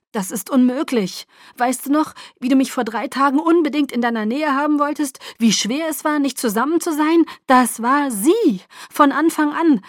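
loud, high, urgent argument; heated, insistent explanation; impassioned pleading. A narrator's reading. Pleading his case in a quarrel.

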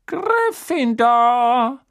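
high, slow, drawn-out proclamation; Sorting Hat calls out. A narrator's reading. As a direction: announce the house like a magic hat. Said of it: "rief der Hut".

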